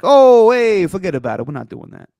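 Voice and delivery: put-on loud, high Italian accent "forget about it", then fading; Italian mobster voice. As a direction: shout it like a New York Italian. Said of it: "And he's like".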